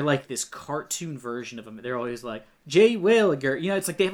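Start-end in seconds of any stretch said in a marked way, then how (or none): 2.7-3.6 mock British-American accent. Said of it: "this bizarre accent".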